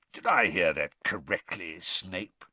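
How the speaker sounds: low, slow, deliberate auror; old auror questioning the teacher slowly. A narrator's reading. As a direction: weigh each word, slowly. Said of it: "he asked slowly".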